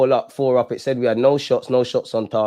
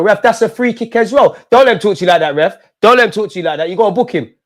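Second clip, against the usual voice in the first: yelling at the ref; 7 semitones higher, 6 dB louder than usual. Real, not acted. Fan yelling at ref on screen.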